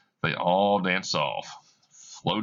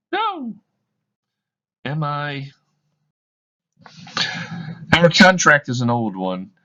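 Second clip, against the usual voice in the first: higher and louder, pleading reproach; woman confronting her fiancé, higher and loud; anguished woman; 7 semitones higher, 8 dB louder than usual. Acted. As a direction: hold him to the old promise.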